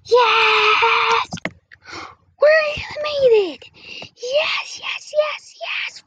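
high shrieking cheer; excited cheering. Acted. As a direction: shout for joy, high. Cheering at becoming cartoons.

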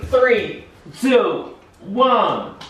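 high eager countdown; excited countdown. Real, not acted. Counting down to lighting the new tree, high and eager. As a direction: count down with rising thrill.

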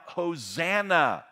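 loud, high, drawn-out cry; screamed acclaim. Retold or imitated. Preacher voices the crowd who hailed their Messiah, screaming out. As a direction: shout it out like a cheering crowd. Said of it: "screaming out".

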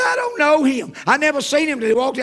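loud, high-pitched insistence; exasperated outburst. Acted. Passenger insists to flight attendant he's a stranger, exasperated. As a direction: deny it at near-shouting pitch.

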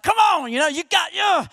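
high, amped-up sideline yell; pumped-up coach. Acted. High school coach on the sidelines, pumped up. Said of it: "I'm kinda like that high school coach".

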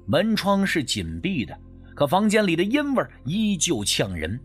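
usual speaking voice

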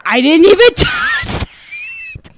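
high, slow, laughing disbelief; laughing protest. Real, not acted. Streamer protesting the game while laughing, high and slow.

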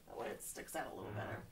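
unvoiced whisper to herself; whispered aside. Real, not acted. Teacher whispering to herself while fetching a marker. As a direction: breathe it as a private aside.

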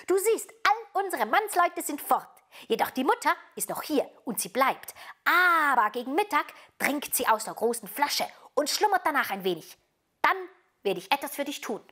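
put-on high-pitched robber girl laying out a plan; scheming robber girl. A narrator's reading. Robber girl telling Gerda her escape plan, bossy. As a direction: explain the plan like a bossy child.

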